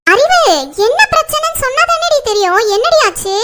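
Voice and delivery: high-pitched, impatient demand; exasperated pleading. Acted. Friend presses the wailing woman for the problem, exasperated.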